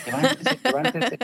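exasperated mom yelling them awake; shrill wake-up yell. Retold or imitated. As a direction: yell at the kids to get up now. Mother waking kids, escalating.

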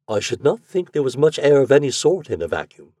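usual speaking voice

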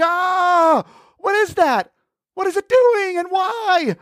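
high screechy panic; panicked shriek. Acted. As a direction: freak out at the spider. Brother freaks at tarantula.

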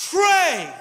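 loud, high shout of a preacher; emphatic exhortation. Real, not acted. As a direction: call it out to the whole congregation.

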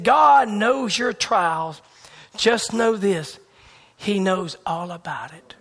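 slow, weighty quote; slow preaching. Retold or imitated. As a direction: lay each word down slowly.

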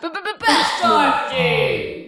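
slow, low movie-trailer stammer; dramatic announcer. Acted. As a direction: boom it like a trailer voice. Mock stutter announcing the scene.

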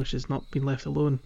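ordinary talk

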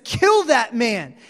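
loud, slow, furious command; the king hears of the rich man, loud and furious; angry outburst. Retold or imitated. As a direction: bellow the order in rage. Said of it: "David arises in anger".

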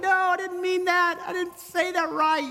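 high, slow, pleading take-back; backpedaling plea. Retold or imitated. After snapping at someone, a high slow pleading take-back. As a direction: try to pull the words back, pleading. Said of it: "You're like".

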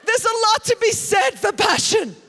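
loud, high preacher proclaiming passion; preaching at full force. Acted. Preacher proclaiming the value of passion, shouted at full force. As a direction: hammer the point to the crowd.

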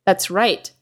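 ordinary talk, nothing marked

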